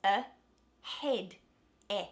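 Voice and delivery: a, head, e split apart; a said as lax schwa, head stressed; syllables of ahead. Shown as a demonstration. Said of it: "stressed syllable in the word ahead".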